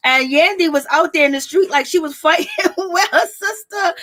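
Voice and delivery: amused, laughter-broken retelling; laughing while talking. Real, not acted. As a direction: tell it through a rising giggle.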